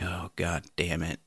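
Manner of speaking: low, slow, soft curse to himself; deflated dismay. Retold or imitated. Opening the door to unwanted neighbors, quietly dismayed. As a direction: sink into quiet, drawn-out dread.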